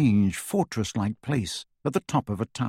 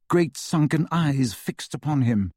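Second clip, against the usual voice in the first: usual speaking voice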